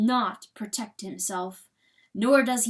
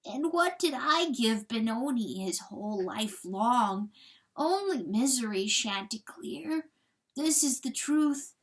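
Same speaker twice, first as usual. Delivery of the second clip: tender, sorrowful confession, lifting higher; gentle grieving coyote; 4 semitones higher than usual. Acted. Coyote figure confesses to rooster.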